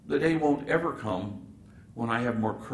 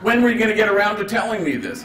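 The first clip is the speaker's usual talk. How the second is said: loud, higher and fast; exasperated yell; 10 semitones higher, 7 dB louder than usual. Retold or imitated. The priest scolding Gabriel over the secret, loud and fast. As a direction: blurt it out in disbelief.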